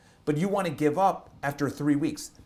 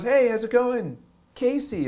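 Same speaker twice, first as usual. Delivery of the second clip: put-on high, bright-eyed girl's hello, louder; mimicked excited girl; 10 semitones higher, 5 dB louder than usual. Girl greeting the vlogger downtown, high and excited. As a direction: play the eager fan spotting him on the street. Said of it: "She goes".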